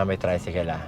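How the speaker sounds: ordinary talk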